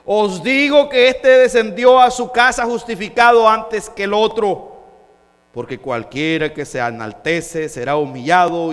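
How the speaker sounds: loud, high proclamation; ringing declaimed verse; forceful scripture preaching. Acted. Preacher delivering Jesus' verdict to the congregation, full force.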